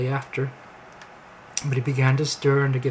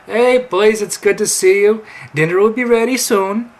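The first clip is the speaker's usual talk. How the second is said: high, loud cheery wife voice; wife calling out; 7 semitones higher, 8 dB louder than usual. Acted. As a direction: call the men to dinner, high and loud. Wife calls out a greeting.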